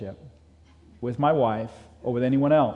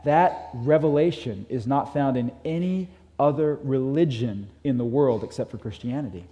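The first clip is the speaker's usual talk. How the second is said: normal talking voice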